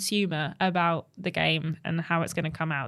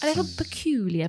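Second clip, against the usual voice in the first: spooky announcer; 6 semitones higher than usual. Pretend. Mock spooky segment intro.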